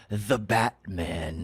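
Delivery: slow, low, menacing Scarecrow; drawn-out creepy villain drawl. Retold or imitated. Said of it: "no one says the Batman like, like Scarecrow".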